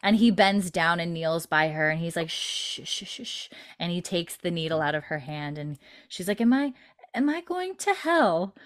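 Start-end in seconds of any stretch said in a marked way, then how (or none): 2.3-3.5 soft, voiceless hushing sounds; imitated soothing shushing. Retelling him kneeling to comfort her. 6.4-8.5 high, halting plea, acted lightly; imitated slight crying. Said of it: "crying a little bit".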